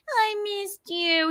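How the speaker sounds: high, slow kitty voice; adoring cat. Acted. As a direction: coo it as a cat to its owner. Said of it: "she's like".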